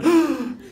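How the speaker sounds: imitated gasp of amazement; mock shocked gasp. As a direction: suck in air, mock shock. Second friend's shocked reaction.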